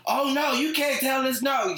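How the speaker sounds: high, slow put-on mom voice; a high, slow, huffy mock of the mom cutting in line; mocking soccer mom. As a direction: whine it up high like the flustered mom. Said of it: "was like".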